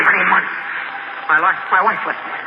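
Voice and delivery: normal talking voice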